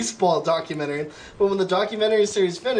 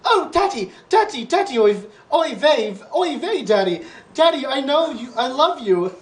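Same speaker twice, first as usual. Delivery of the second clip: put-on much higher child's voice, a bit louder; screaming little boy; 7 semitones higher, 3 dB louder than usual. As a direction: squeal it like an excited little kid. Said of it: "and screamed at the bread".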